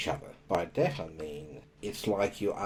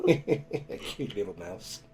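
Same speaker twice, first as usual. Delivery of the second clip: laughing; 3 semitones higher, slower than usual. Real, not acted. Teasing after her mouse remark.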